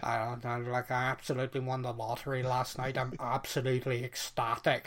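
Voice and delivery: a lightly done, glum Northern Irish voice; a touch of a flat Northern Irish accent, deadpan over good news; depressed Northern Irish accent. Retold or imitated. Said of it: "gotta sound depressed".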